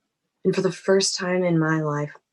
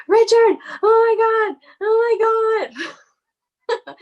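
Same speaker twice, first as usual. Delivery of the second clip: high, loud shrieks of being caught; panicked high-pitched screaming, acted out; alarmed shriek; 7 dB louder, much slower than usual. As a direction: shriek in shock, stretching out the last cry. Woman caught cheating shrieks in alarm.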